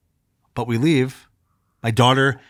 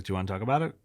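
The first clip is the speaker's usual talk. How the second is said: soft gentle wife voice; 6 semitones lower, 12 dB quieter, much faster than usual. Retold or imitated. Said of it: "my wife's like".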